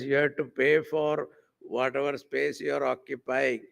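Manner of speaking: usual speaking voice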